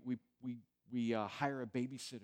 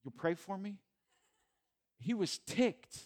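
higher and louder, punching the word; emphatic outrage; 6 semitones higher, 4 dB louder than usual. Real, not acted. Pastor stressing Jesus' anger to church, emphatic. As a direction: let the outrage burst out. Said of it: "Can I say the P word in church?".

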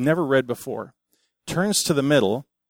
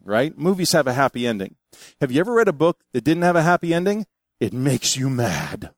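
ordinary delivery

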